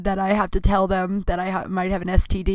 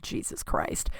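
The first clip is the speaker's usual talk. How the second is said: breathy, low, near-silent "Jesus Christ"; hushed exasperated aside; muttered under breath; 10 dB quieter, slower than usual. Retold or imitated. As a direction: mutter it to yourself in dismay. Dismayed reaction to a text.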